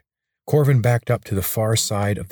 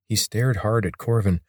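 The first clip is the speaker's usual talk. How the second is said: usual speaking voice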